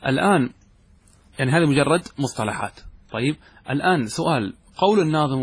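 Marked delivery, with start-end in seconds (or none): none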